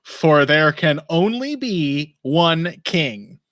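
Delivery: very slow, odd over-enunciation; slow, grandly over-enunciated proclamation; John Malkovich impression. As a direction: draw each word out with strange, careful diction. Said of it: "The strange way he enunciates everything".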